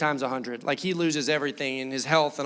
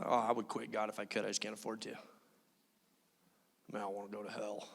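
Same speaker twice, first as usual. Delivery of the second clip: mocking whiner; 8 semitones lower, 14 dB quieter, faster than usual. Mocking people who won't quit.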